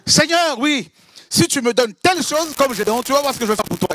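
high, pleading voice haggling with God; lightly hammed-up pleading believer; mock bargaining prayer. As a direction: bargain with the Lord like a trader. Preacher acts a bargaining prayer.

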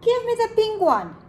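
very high, loud little penguin. Acted. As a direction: squeak out a demand, high and loud. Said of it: "said the penguin".

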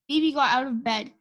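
usual speaking voice

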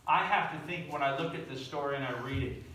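ordinary talk

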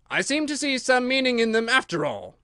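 high, self-pleased king; pompous king. Acted. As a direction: discover it like a foolish king. King finding meaning.

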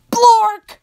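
loud, high silly blurt; silly nonsense shout. Shown as a demonstration. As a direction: blurt it out like a cartoon. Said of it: "even if the words say".

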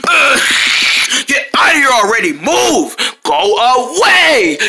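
hammed-up exasperated outburst, last words drawn out; exasperated shooing. Shooing a fly that won't leave, exasperated outburst. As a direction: lose patience and stretch out "away".

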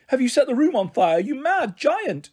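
high, alarmed fairy shriek, slowing on mad giant; frightened fairy crying out. A narrator's reading. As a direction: panic at the blinding light. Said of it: "she cried".

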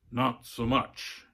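slow, hard-edged answer. A narrator's reading. Poll worker answers worried voter, hard voice. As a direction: weigh each word, hard and unyielding. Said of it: "he said, his voice hard".

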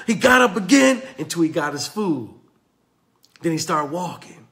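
ordinary delivery

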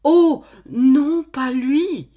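high, loud shocked outcry; alarmed cry. Acted. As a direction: cry out in denial. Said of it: "Elle s'écria".

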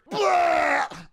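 high-pitched vomiting noise; mock retching. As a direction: act out throwing up. Acts out vomiting once more.

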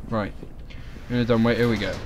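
ordinary delivery

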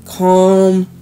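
spaced-out calming instructions with long pauses; guided breathing. Real, not acted. Tarot reader calming a nervous Aries, guided breathing. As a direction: lead them through a breath, word by word.

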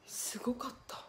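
breathy whisper of amazement; whispered awe. Real, not acted. Streamer to chat, awed whisper. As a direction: hush it like a secret thrill.

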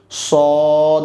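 সদ held long with rounded lips. Shown as a demonstration. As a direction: round the mouth and stretch সদ. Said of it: "ঠোঁটকে একটু গোল করে আমরা বলবো". (ঠোঁট গোল করে সদ)

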